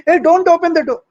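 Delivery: high, loud yell at the screen; shouting at the screen. Retold or imitated. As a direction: yell a warning at the girl on screen. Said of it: "he was shouting at that girl".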